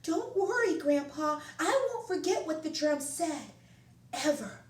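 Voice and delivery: put-on high, loud child voice full of pride; proud little boy. Mancho promises his grandpa he won't forget, proud and high. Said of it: "Mancho said proudly".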